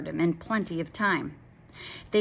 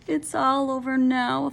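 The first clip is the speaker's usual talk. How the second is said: despairing dragon; 7 semitones higher, 3 dB louder than usual. A narrator's reading. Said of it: "thought the dragon".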